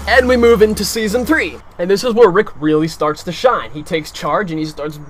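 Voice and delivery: ordinary talk, nothing marked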